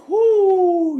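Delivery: sudden high loud whoo, half sob; imitating a high loud crying whoo; high cry of release. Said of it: "hit this gong here and cried".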